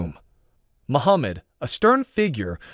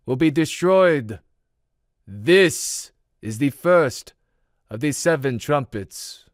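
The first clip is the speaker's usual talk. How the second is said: high, measured prophetic voice; calm, foreboding prophet; 5 semitones higher than usual. A narrator's reading. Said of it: "Elijah's voice was calm, yet foreboding".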